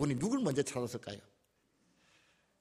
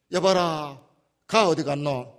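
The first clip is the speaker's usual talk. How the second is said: louder Gyeongsang dialect summons; a master in Gyeongsang dialect calling for the girl; 6 dB louder, slower than usual. Retold or imitated. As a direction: call the servants in thick Gyeongsang dialect. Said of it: "우리 경상도 말로 치면은".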